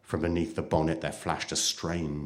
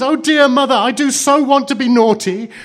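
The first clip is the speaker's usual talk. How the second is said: put-on high, loud childish wailing; sobbing child; 15 dB louder than usual. A narrator's reading. Child confesses to her mother, sobbing loudly. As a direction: bawl it out, high and desperate. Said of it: "sobbed Blue Eyes".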